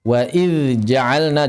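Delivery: "idz" held clear and apart from "ja'alna"; "wa idz ja'alna" slow, every consonant distinct; clear dhal. Shown as a demonstration. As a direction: slowly buzz "idz" before "ja'alna".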